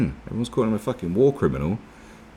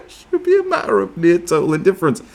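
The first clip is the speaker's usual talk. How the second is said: high, loud reading through real laughter; laughing; 13 semitones higher, 6 dB louder than usual. Real, not acted. Reading the article after mocking the neighbours, laughing. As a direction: read on while cracking up.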